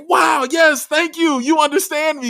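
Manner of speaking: joyful mock shout of gratitude; excited cheer. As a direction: shout thanks like a relieved fan. Imagined fan thanking the team.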